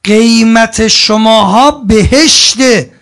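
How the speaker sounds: slow booming declaration of worth; loud proclamation. Real, not acted. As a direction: proclaim it slowly to the whole hall. Preacher proclaims to congregation. (اعلام بلند و کشیده)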